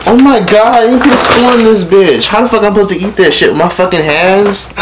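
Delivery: high-pitched real exasperation; exasperated complaint. Real, not acted. Vlogger stuck eating without cutlery, exasperated complaint.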